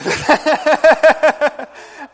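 loud high laugh breaking out; sudden hearty laugh; burst of laughter. Real, not acted. Laughs mid-sermon.

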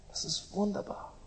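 soft, awed echo; hushed repeat. Acted. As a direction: let it fall away into a hush. Savouring repeated phrase.

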